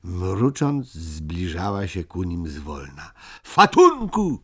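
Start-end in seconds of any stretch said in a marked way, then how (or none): 3.4-4.4 high, loud shriek; frightened-style cry for help. A narrator's reading. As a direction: shout it out sharply, way up high. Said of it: "krzyknęła Topcia". (wysoki, głośny okrzyk)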